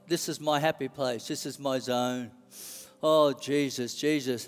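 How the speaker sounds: soft, low contented prayer; blissful praying spirit. Acted. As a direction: settle quietly into your happy place. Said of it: "The spirit guy's like".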